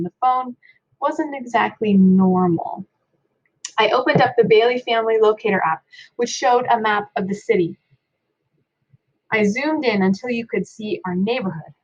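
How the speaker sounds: ordinary delivery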